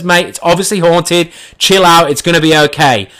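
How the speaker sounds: high, loud tense reassurance; calm him down loudly; reassuring friend. Retold or imitated. Friend reassuring amid panic.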